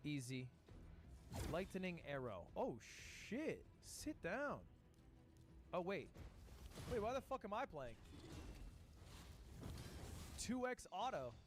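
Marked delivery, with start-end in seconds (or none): none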